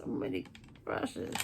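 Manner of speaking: breathy whisper of the phrase; whispered echo. Real, not acted. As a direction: repeat it in a whisper. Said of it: "whispered echo".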